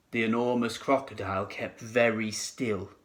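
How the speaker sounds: ordinary delivery, nothing marked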